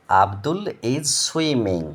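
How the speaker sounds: "Abdul is swimming" drawn out very slowly; slow teacher reading; slow model reading. Shown as a demonstration. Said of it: "দেখো".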